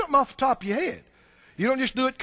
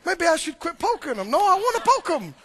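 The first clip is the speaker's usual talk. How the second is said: higher, fretful devil voice; a lightly voiced devil who cannot win; frustrated devil; 7 semitones higher than usual. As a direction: dither as the baffled enemy. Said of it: "And he goes".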